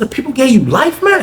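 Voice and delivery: high, lifted disbelief; mock stunned outburst; shocked question. Young inmate learns Cliff has life, in high disbelief.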